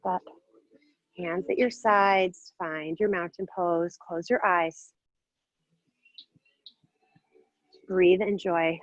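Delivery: a hint of meditation voice; guided calm. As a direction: ease them inward slowly. Slow guided balance hold.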